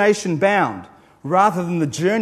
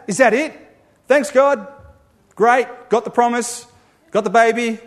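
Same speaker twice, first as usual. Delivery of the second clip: higher, louder mock-casual Abraham; flippant brush-off; 5 semitones higher, 5 dB louder than usual. Preacher mocks Abraham thanking God, in a lightly voiced flippant tone. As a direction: breezily dismiss God, job done.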